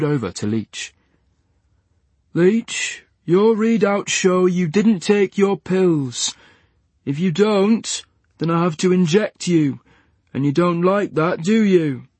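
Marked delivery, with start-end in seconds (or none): 2.3-12.1 higher, slow, sing-song talking down; patronizing. A narrator's reading. Nurse talking down to a patient, patronizing. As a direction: coax him like a naughty child. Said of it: "Barney's tone was nauseatingly patronizing".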